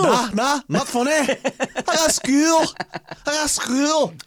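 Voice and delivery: very high, very slow drawn-out boast; mock bragging nerd. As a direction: whine the brag out like a smug show-off. Joins mocking bragging nerd.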